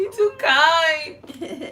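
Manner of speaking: laughing. Real, not acted. High slow laughing reply.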